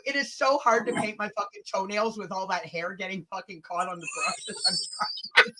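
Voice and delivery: ordinary talk, nothing marked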